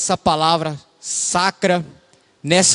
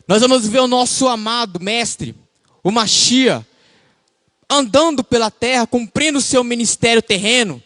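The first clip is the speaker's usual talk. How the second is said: higher, raised preaching voice; 6 semitones higher than usual. Acted. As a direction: lift into the sermon. Preacher rises into sermon. (pregação empolgada)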